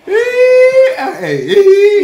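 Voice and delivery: high, loud hey cries; excited cry. Real, not acted. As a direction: squeal with excitement. Crying out at the food.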